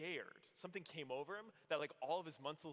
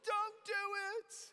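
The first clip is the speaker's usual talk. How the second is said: put-on much higher, squeaky girlish plea; worried girls; 5 dB louder, slower than usual. Party girls begging the roof jumper to stop, squeaky plea. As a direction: plead like a chorus of anxious girls. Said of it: "all of the girls in unison were like".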